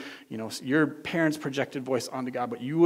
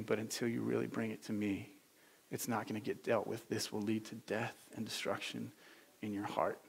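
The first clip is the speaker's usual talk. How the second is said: low, soft, grave reassurance; Father's gentle voice; 3 semitones lower, 6 dB quieter than usual. Acted. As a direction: counsel tenderly, without any scolding. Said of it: "the voice of your Father saying".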